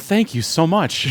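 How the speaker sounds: gushing thanks. Retold or imitated. Actor thanking the director.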